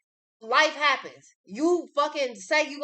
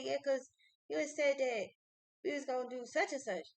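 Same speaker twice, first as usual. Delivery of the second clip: shy niece voice; 9 dB quieter than usual. Retold or imitated. Niece explaining her disappointment.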